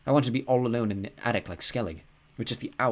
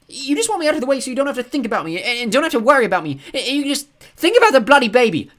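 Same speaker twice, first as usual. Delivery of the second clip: furious shout, much higher and louder; angry shouting; 13 dB louder than usual. A narrator's reading. Boy refusing to go to school, shouting. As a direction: shout your refusal at your dad.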